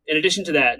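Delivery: ordinary talk, nothing marked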